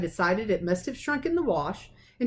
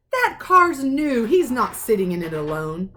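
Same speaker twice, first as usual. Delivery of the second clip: higher, much louder, slow outburst; angry uncle protecting his car; indignant uncle; 4 semitones higher, 6 dB louder than usual. Acted. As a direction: bluster it, outraged. Vernon refuses, blustering.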